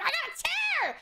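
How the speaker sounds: high, excited mock squeal; aunt gushing about her new chair, excited squeal; loud excited aunt. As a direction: squeal it like the overexcited loud one. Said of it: "the loud one that's like".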